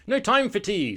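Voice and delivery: high, loud and urgent; hurried refusal. Acted. Terry refuses tea while fleeing, urgent cry. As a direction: call it out on the run.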